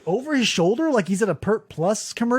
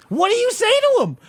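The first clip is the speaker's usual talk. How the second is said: exasperated high outburst; 14 semitones higher, 4 dB louder than usual. Real, not acted. Exasperated rant at the guy.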